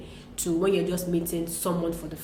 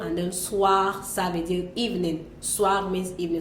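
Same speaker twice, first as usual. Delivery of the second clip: ordinary delivery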